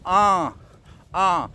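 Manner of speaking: Ah, ah as a held nasal vowel. Shown as a demonstration. Teacher demonstrating the as rune, nasal sound. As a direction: send each Ah through the nose. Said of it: "That is a nasal sound".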